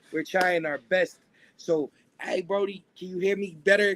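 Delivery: ordinary talk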